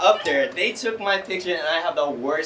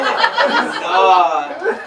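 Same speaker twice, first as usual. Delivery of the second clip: laughing oh God; 3 semitones higher, 8 dB louder than usual. Retold or imitated. Hearing his name called, laughing in shock. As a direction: laugh it out, caught off guard. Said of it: "And I was like".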